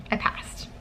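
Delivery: breathy whispered disbelief. Real, not acted. Student savoring the news, disbelieving whisper. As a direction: whisper it as if it can't be true.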